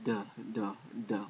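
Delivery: "D, D, D" as short separate "duh" syllables; D sound. Shown as a demonstration. Teacher demonstrating Dalet's sound, "D" said as short "duh". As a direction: sound out "D" as "duh", one at a time. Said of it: "Dalet is a D sound".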